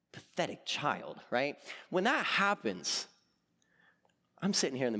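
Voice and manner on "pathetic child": low, slow mock-villain voice; scornful betrayer. Hans betrays Anna in the retold film, slow low scorn. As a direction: draw out the contempt, deep and low.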